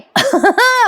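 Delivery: high bright burst of a gloating laugh; gleeful laugh. Acted. Wife laughing at her husband's coming ordeal, gleeful. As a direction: laugh in delight at the sister-in-law's trouble. Sayli gloating over Priya cooking.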